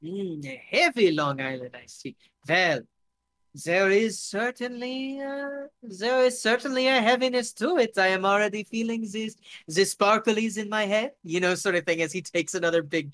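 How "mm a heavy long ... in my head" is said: put-on savoring it in a German-French accent; high, lilting Franco-German taster; tipsy-happy newcomer with a German-French accent. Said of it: "he's switching between German and French".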